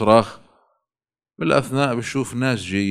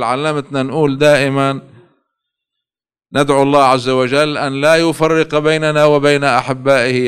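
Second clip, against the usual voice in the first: loud, fervent plea to the Imam; impassioned preaching; 3 semitones higher, 4 dB louder than usual. Acted. Preacher pleading with Imam Ali, impassioned preaching. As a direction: cry out your supplication with full force.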